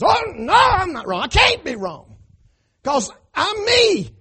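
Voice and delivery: high, loud indignant mock protest; mocking a proud fool. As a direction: play the fool who can't be wrong. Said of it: "He says".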